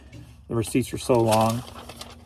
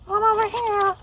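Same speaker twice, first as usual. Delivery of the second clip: very high, squeaky put-on voice; piping falsetto reply; pupil answering roll call.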